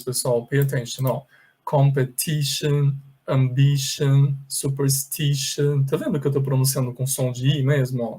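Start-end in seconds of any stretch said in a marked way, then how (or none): 1.6-5.8 competition, ambition, superstition said slowly with a clear i; slow model reading. Shown as a demonstration. Teacher slowly reading the word list aloud. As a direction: read each word slowly, i as in ee. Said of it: "olha só como eu vou ler essas palavras".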